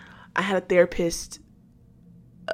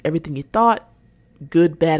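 usual speaking voice

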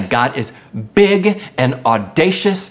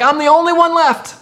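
high-pitched lament of feeling alone; acted outburst of someone spiritually empty; self-pitying complaint; 10 semitones higher, 3 dB louder than usual. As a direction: plead it high, as if nobody else understands. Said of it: "we start to say things like".

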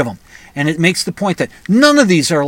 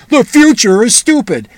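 louder and higher, a sarcastic punchline; mocking retort; 7 semitones higher, 8 dB louder than usual. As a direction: throw the line back at them, raised and mocking. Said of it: "And I said".

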